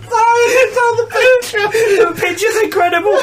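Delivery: high, loud, laughing through the words; can barely speak for real laughter; helpless laughter. Real, not acted. Reader cracks up at the story's picture, helpless laughter.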